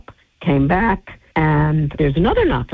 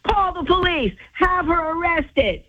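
much higher, emphatic group verdict; mock chorus, emphatic; 12 semitones higher than usual. The whole West Coast Bureau on the phone urging her, high emphatic verdict. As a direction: sing out the verdict like a crowd of colleagues. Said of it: "And everybody said".

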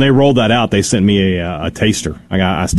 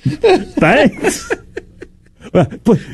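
put-on high, laughing Australian accent; mock Australian accent; much slower than usual. Dad keeps the mock Australian accent while laughing. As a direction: keep the silly Aussie voice while laughing. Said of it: "in that voice".

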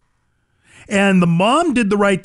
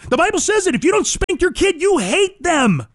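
high-pitched, insistent proclamation; impassioned repeat at a much higher pitch; emphatic preaching; 13 semitones higher than usual. Real, not acted. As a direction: hammer it home, stretching "you hate them". Emphatic repeat of Bible saying.

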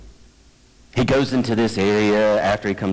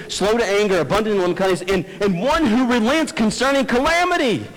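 raised pitch, building to an incredulous peak; indignant recital; 8 semitones higher than usual. Acted. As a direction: list the virtues like charges, climbing at the end. Acting out Jonah's indignant prayer.

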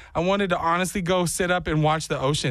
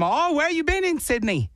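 higher put-on Australian; fake Australian accent; 10 semitones higher than usual. He quizzes tourists about Sydney, higher fake Australian accent. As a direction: ask in a bogus Australian accent. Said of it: "that's kinda how I, uh, start".

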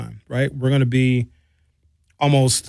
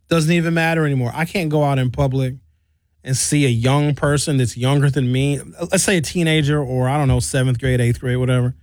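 usual speaking voice

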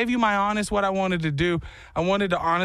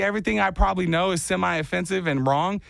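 ordinary delivery

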